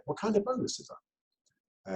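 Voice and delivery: high, quiet curious ask; curious question. Shown as a demonstration. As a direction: probe gently for detail. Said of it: "lazy Jedi questions".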